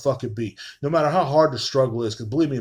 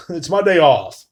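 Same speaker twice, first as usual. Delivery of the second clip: ordinary talk, nothing marked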